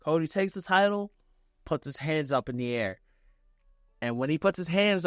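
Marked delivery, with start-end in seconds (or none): none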